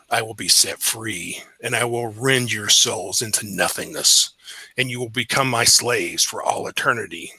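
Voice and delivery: low, slow demonic threat; cold rasping evil emperor. Acted. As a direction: menace them like a demon from hell. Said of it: "you'll hear a voice that says".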